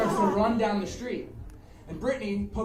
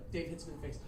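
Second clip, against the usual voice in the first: usual speaking voice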